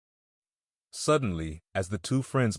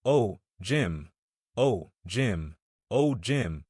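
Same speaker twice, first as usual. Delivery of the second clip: usual speaking voice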